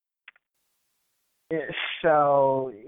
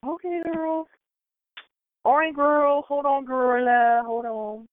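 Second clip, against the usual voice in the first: high, drawn-out singsong replies; mock phone chat; 7 semitones higher, slower than usual. Woman stalling someone on the phone, playful singsong. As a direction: draw it out high, winding up the call. Acted phone call to plug.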